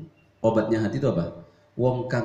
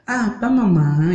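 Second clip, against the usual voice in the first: higher, louder, slow whiny reply; mock meek husband; 7 semitones higher, 7 dB louder, slower than usual. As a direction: whine it like a wimpy husband. Husband too soft mockery.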